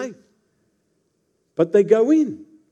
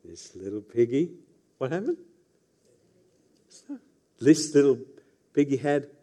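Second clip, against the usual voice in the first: lightly sing-song piggy rhyme, softer; nursery rhyme; 4 semitones lower, 3 dB quieter than usual. Retold or imitated. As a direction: play the toe game like a mocking parent. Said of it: "and I would go".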